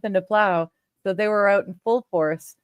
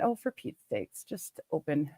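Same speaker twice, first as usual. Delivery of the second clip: quiet frustrated mutter; muttering under breath; 4 semitones lower, 11 dB quieter than usual. Real, not acted. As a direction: grumble it low to yourself. Mutters at stuck program.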